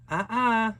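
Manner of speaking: high, loud cautioning cry; warning mother. Acted. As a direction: stop the child with a sharp warning.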